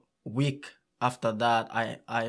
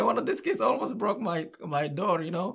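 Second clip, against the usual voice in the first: higher, lightly voiced neighbor; imitating the neighbor; 6 semitones higher than usual. The neighbor complaining about a kid who nearly broke his door, higher. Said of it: "He said".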